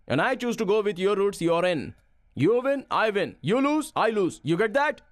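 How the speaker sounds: emphatic ultimatum. Acted. Trainer telling the trainee you're in, emphatic. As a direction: lay down the terms, lifting each phrase high. Stern deal laid down to trainee.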